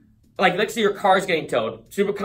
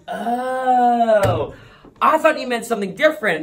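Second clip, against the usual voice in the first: lightly voiced clerk, higher and louder; a hint of a surprised shop lady catching on; imitating the store clerk; 5 semitones higher, 4 dB louder, slower than usual. Store clerk realizing her mistake, mock surprised voice. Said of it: "And she goes".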